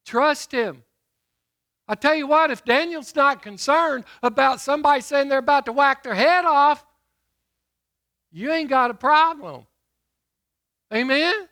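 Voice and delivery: loud, ringing pulpit delivery; booming sermon voice; preaching at full force. Acted. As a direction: preach it out to the congregation. Preacher exhorting.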